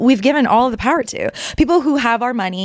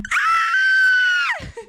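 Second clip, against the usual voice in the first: high cackling laugh after screaming; real giddy laugh; laughing shriek. Real, not acted. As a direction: break into a squealing laugh.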